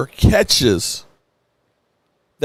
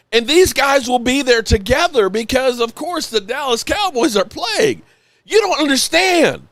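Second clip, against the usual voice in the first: much higher, pitch soaring with emotion; overwhelmed excitement; 10 semitones higher than usual. Real, not acted. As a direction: overcome with excitement about the news. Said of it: "literally a wave of emotion".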